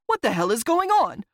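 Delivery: rough, threatening. A narrator's reading. Roslo pulls the narrator close to demand answers, threatening. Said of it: "he said in a rough, threatening voice".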